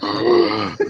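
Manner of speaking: high audible intake of breath; startled high gasp; sharp gasp. Real, not acted. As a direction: gasp at the very idea. Reacts to the spinneroonie suggestion.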